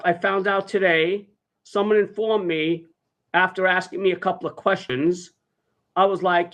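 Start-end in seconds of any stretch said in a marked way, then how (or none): none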